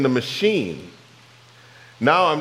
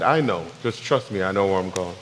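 reassuring God; 7 semitones lower, 4 dB quieter than usual. Pretend.

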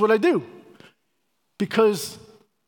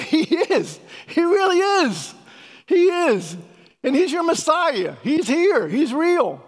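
excited proclaiming; 8 semitones higher, slower than usual. Retold or imitated. Joyful proclaiming to converted coworker.

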